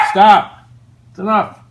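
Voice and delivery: loud high stern shout; shouting at the dog. Real, not acted. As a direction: scold the dog sharply. Yelling at barking dog.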